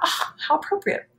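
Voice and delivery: high, airy inner thought; high quoted thought. Retold or imitated. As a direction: lift it up like a sudden idea. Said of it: "So I thought".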